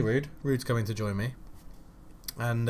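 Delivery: ordinary delivery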